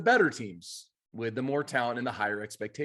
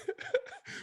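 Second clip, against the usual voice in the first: breathless laugh; 8 dB quieter than usual. Real, not acted. Laughing about the rally.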